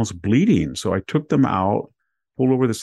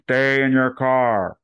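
bullhorn order; 4 semitones higher, slower than usual. Retold or imitated. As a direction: bark it like a sheriff on a megaphone. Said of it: "he had a bullhorn".

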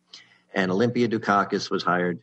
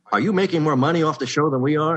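higher, louder, fast urgent demand; agitated retold question; 7 semitones higher, 4 dB louder, faster than usual. Writer Steve on the phone demanding answers, higher and fast. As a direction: fire it off like a suspicious partner.

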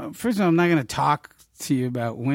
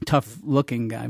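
ordinary delivery

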